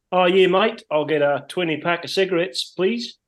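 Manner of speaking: a hint of a laid-back Kiwi bloke; broad kiwi accent. Retold Kiwi coworker in shop.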